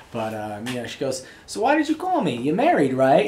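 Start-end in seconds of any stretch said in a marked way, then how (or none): 1.5-3.3 much higher, louder mock New York girl; New York accent. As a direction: squeak it out like a Jersey girl teasing him. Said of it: "in her New York accent".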